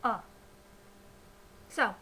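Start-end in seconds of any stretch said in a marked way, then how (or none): 0.0-0.2 a lone short "uh", the schwa, written "A"; isolated schwa "A", as in "uh"; schwa sound. Shown as a demonstration. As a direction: say "A" as a neutral, unstressed "uh". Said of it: "Schwa is an unstressed sound like this".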